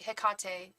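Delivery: Hecate said heh-KAH-tay, middle syllable stressed; stress on second syllable. Shown as a demonstration. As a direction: put the weight mid-word in Hecate: heh-KAH-tay. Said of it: "Yeah, you're right".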